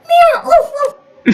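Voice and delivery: high-pitched meow, then puppy woofs; muddled cat-and-puppy noises; imitated puppy. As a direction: yelp like a small animal, high and playful. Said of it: "You know what a puppy is?".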